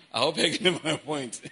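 said through a laugh. Real, not acted. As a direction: laugh while making the point. Teasing remark to the congregation.

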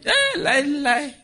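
very high squeal, laughing; mocking laugh. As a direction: squeak it out in mock disbelief.

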